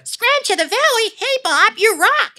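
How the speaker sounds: very high squeaky cartoon voice; high-pitched kids' cartoon character; Scrambler's voice. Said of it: "all I had to do was basically go".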